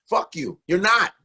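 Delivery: usual speaking voice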